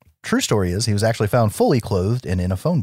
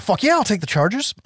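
eager relief; 10 semitones higher than usual. Retold or imitated. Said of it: "And Mike's like".

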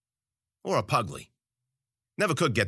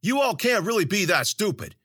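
high, exasperated scolding; snapping; 10 semitones higher, 3 dB louder than usual. A narrator's reading. Zoot berating the clueless crowd, snapping. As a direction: berate them in disbelief, pitched up. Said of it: "he snapped".

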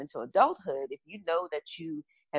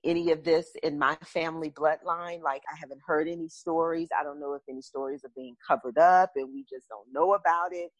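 ordinary talk, nothing marked

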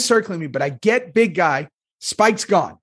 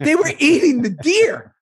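exasperated retold answer; 11 semitones higher, 3 dB louder than usual. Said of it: "And I go".

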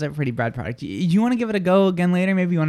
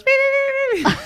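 mocking squeaky beeps. Host mimicking cohost's failed product pitch, squeaky beeps. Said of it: "how you were like".

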